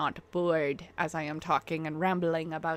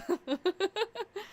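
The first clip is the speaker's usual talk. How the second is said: soft giggle; 11 semitones higher, 6 dB quieter than usual. Real, not acted. Joke about Walmart brand.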